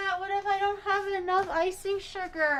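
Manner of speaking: high-pitched wail to the audience; worried whine. Real, not acted. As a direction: fret aloud, pitched high. Worries aloud to chat.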